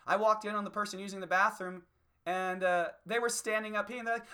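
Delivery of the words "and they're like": normal talking voice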